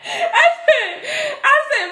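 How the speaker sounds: high, breathless laugh through the words; can't stop laughing at the little one. Real, not acted. Still laughing at niece.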